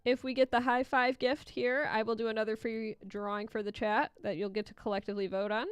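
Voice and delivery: ordinary talk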